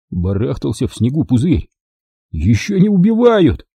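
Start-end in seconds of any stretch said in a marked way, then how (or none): none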